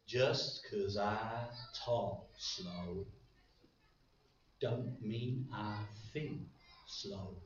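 very slow Texan drawl, each word stretched; slow, drawn-out Texan drawl. Retold or imitated. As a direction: drag it out like a slow-talking Texan. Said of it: "he used to talk very slowly in a Texan drawl".

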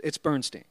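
"Bernstein" punched loud and high, ending -steen; loud comic correction, "Bernstein" rhyming with seen; indignant correction. Acted. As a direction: snap back with BERN-steen as the punchline. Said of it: "Miss Bernstein".

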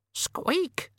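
tiny, very high breathy squeak; whispered rat squeak. A narrator's reading. The rat answering the raven, very high whispered squeak.

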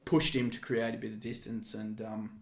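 low, soft mock report; offhand write-up recital. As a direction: rattle off the official version under your breath.

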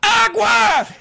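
high, loud shouted "Agua!"; yelled demand. Retold or imitated. Sweaty customer shouting for water at counter, loud yell. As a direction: bellow "Agua!" like a desperate thirsty man. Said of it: "once more shouted".

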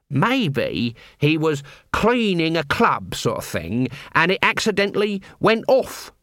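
put-on voice floating a theory; speculating sidekick. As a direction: muse slowly, drawing out the words. Nobby voiced, offering a theory.

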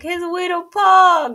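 high, loud, slow mock sob; mock crying. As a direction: wail it, hammed-up heartbreak. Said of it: "We were like".

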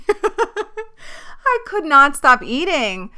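slow, laughing through the words. Real, not acted. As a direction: laugh it out, drawn out. Laughing about endless hunger.

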